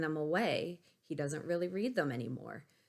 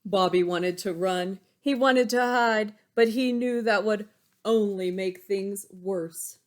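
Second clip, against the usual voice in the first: louder, higher dramatic read-aloud; loud, higher storytelling voice; excited storybook narration; 5 semitones higher, 10 dB louder than usual. Acted. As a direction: make the kids feel his panic. Reads picture book aloud.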